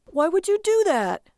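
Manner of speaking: high, loud exasperated question; incredulous and shrieky; appalled wife. A narrator's reading. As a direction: demand to know why on earth. Wife appalled at his purchase.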